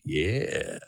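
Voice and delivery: ogling leer. Retold or imitated. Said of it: "supposed to turn and look at him like".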